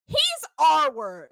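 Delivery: put-on a ten-year-old blurting it out, loud and flat; forceful blurt. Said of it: "the force with which she said it".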